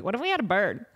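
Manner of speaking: lightly voiced cute Southern accent; a hint of her sweet accent; imitated adorable accent. Imitating Jamie Lynn's cute accent asking about a bird. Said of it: "in her freaking adorable accent".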